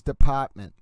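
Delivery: department with the R dropped, "depahtment"; imitating judge's pronunciation. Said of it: "I love the way Judge".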